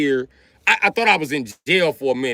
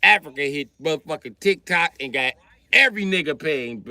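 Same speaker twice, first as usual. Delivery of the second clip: ordinary delivery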